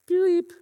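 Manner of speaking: high, loud bleep noise; imitated bleep. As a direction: do a censor bleep. Censor bleep sound.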